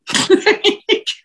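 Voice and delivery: a high, mocking little laugh imitating her; imitated laugh. She laughs on the jail call to her husband, high imitated laugh. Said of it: "You can hear it".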